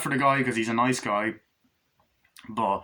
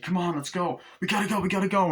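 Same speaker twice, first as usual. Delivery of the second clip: urgent hurry; 8 semitones higher than usual. Retold or imitated. Urgent friends rushing to sister.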